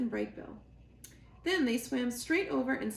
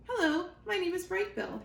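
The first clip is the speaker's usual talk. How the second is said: gosling calls out to the otters, raised and high; shouting over waves; 9 semitones higher than usual. Acted. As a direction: call out across the waves to the otters. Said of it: "he shouted over the waves".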